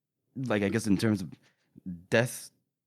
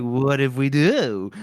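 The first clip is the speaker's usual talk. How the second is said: slow mock menace; 2 semitones lower, 3 dB louder, slower than usual. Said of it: "he's like".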